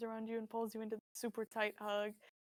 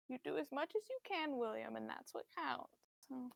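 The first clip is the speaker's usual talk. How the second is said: frail sympathetic mother; 7 dB quieter than usual. Acted. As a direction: comfort him softly. Mother voice soft.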